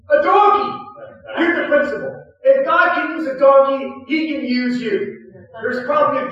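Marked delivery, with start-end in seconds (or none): 0.1-0.8 bigger exclamation. Real, not acted.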